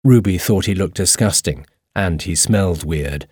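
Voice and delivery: usual speaking voice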